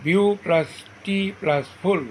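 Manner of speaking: "বিউ plus টি plus ফুল" in three spaced beats; syllable breakdown. Shown as a demonstration. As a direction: set "বিউ", "টি" and "ফুল" apart. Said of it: "যে ওয়ার্ডে তিনটি syllable থাকে".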